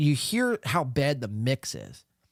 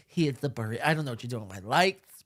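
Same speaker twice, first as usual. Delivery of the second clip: usual speaking voice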